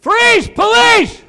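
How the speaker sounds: very high loud shouted command; shouted police command; Chicago cop yell. Retold or imitated. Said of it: "I'll do an impression for you".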